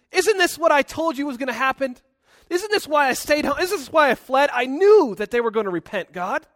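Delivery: loud, indignant I-told-you-so; pouting Jonah. Acted. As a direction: throw hammed-up accusations at God. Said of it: "Jonah's pouting here".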